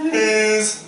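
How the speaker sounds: high, loud mocking wife voice. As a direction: squeak out the wife's yes. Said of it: "that's my voice for her".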